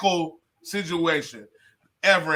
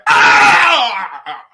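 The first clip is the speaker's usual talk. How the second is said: big loud high "ah" of a shocked crowd; audience outcry; 7 semitones higher, 12 dB louder than usual. Retold or imitated. Comic imitating a shocked audience's outcry, long and loud. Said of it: "audience was like".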